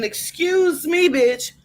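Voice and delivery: high-pitched, sassy acted outrage; indignant guest. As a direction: tell off the meddling attendant. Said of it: "And you at this point is saying".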